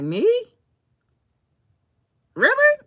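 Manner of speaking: very high, loud incredulous squeal; can't believe the compliment; disbelief. Retold or imitated. Timid girl hearing she is bubbly, squealing disbelief. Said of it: "And I'm like".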